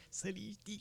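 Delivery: mock stroke-out mumble, soft; mocking mangled word. Teasing his co-host who can't say it, mumbling. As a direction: imitate him failing the word. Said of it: "You always can't get it out".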